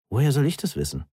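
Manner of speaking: slightly testy comeback; irritated. A narrator's reading. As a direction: answer with a touch of irritation. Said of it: "Justin wirkte gereizt".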